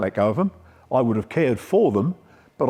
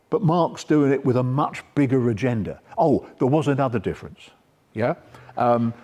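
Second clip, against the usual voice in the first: ordinary talk, nothing marked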